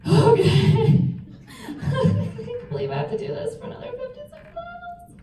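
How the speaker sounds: high laughing disbelief; laughing through tears. Real, not acted. Laughing at her own crying.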